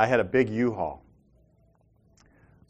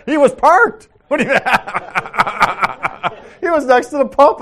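laughing; 12 semitones higher, 11 dB louder than usual. Real, not acted. Laughing disbelief at the story.

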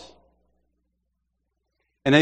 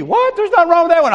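much higher, incredulous wife's voice; wife's indignant protest; 13 semitones higher, 5 dB louder, faster than usual. Retold or imitated. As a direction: play the wife shooting down the idea, pitched up. Wife refuses a new TV.

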